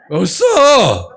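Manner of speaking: loud, drawn-out 없어; emphatic shout. Real, not acted. Preacher shouting that Gyeongsang men never apologize. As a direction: stretch and shout it out.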